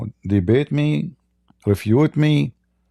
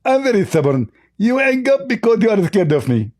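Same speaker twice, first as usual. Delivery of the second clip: high-pitched, lisping mock debater; Zakir Naik; 9 semitones higher than usual. Host mimicking his debate opponent, high lisping impression. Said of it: "Hey, Zakir Naik".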